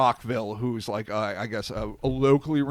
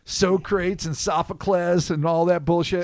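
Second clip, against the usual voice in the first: higher, louder fed-up demon; exasperated demon voice; 6 semitones higher, 4 dB louder than usual. Acted. Demon voice ad-libbed.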